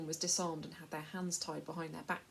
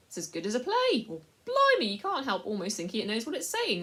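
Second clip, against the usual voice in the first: higher, louder coarse delight; amused slaver; 5 semitones higher, 10 dB louder than usual. A narrator's reading. Slave dealer enjoying the mouse's rant, coarse amusement. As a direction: laugh at the mouse like a show.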